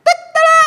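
shrill cock-a-doodle imitation, very high and loud; the rooster crowing over Peter's denial. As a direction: crow like the rooster at dawn. The rooster crows mid story.

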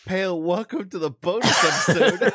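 excited host greeting. Retold or imitated. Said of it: "Keith was like".

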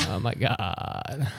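vocal fry. Real, not acted. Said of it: "How's my, how's my vocal fry?".

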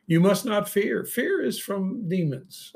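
high, loud, insistent command; forceful exhortation. Real, not acted. Preacher warning listeners against fear, forceful. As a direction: press it hard on the crowd.

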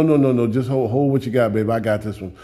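put-on soft, low, gentle voice calming a child; tender reassuring God. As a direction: hush the worried one kindly. God soothing the believer.

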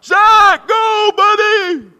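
full-voiced, drawn-out high shout from the sidelines; cheering on. Dad cheering his son at soccer, loud cheering. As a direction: yell encouragement like the biggest cheerer. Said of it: "they always needed that cheering on".

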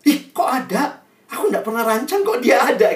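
high-pitched, comic astonishment; mock startled. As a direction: act out being caught completely by surprise. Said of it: "Tuhan kaget". (pura-pura kaget)

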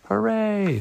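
high, drawn-out hooray, no real volume; shouted cheer. A narrator's reading. The fish celebrate their friend being freed, a shouted cheer. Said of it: "shouted the other fish".

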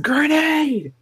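high, drawn-out battle yell; mock grenade scream. Two friends playing Call of Duty at school, screamed. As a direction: holler it like a video game warning. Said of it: "We scream".

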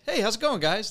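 high, chirpy greeting; overly cheerful hello; naive friendly. Retold or imitated.

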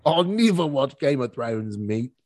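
slow, broad mock Australian accent; hammed-up Australian accent. Shown as a demonstration. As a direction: ham up the Aussie vowels, drawn out. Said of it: "I like Australian accents a lot".

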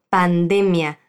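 "pandemia" with the stress on "de", pan-DE-mia. Shown as a demonstration. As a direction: clear model of "pandemia", stress on "de". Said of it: "La sílaba tónica de esta palabra en español es la D".

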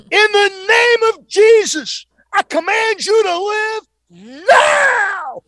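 high, slow, full-force command; top-of-the-lungs scream. Retold or imitated. Preacher commanding a dead man to live, screamed at full force. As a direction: holler each word out long, as if raising the dead. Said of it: "I screamed at the top of my lungs".

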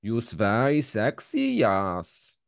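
slow, cartoon Swedish accent. Trying to impress the co-host, cartoon Swedish accent. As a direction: drag the words out in a hammed-up Swedish accent. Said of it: "That's the cartoon version".

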